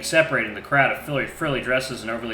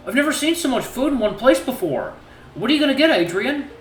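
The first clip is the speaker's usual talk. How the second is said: put-on higher, excited shy boyfriend; wide-eyed and eager; 13 semitones higher, 4 dB louder than usual. Voices Cecil.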